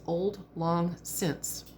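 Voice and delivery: each word drawn out very slowly; slow translation. Shown as a demonstration. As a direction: spell out the old phrase slowly and clearly. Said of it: "the literal translation of Auld Lang Syne is".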